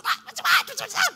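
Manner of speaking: high loud angry scolding; mock angry outburst. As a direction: blow up in a hammed-up rage. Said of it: "Marah juga nggak harus". (marah-marah pura-pura, keras dan tinggi)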